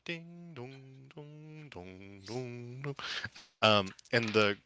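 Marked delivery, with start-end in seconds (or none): 0.0-3.0 soft, slow sung chime notes; imitated chimes. DM imitates the mansion doorbell chimes, softly sung. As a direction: hum out a melodic doorbell chime, slowly.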